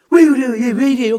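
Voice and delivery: alarmed. Retold or imitated. Alarmed night at church.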